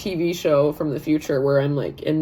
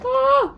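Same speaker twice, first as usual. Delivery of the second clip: excited squeal; 4 dB louder than usual. Retold or imitated. Squealing about meeting namesake.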